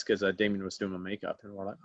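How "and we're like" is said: ordinary talk, nothing marked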